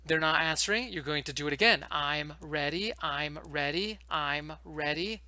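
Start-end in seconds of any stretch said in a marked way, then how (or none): 1.9-5.2 "I'm ready" stretched slowly, rising on "ready" each time; emphatic modelling. Shown as a demonstration. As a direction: repeat "I'm ready" evenly, slow and deliberate, coaxing a reply. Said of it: "this is how you emphasize".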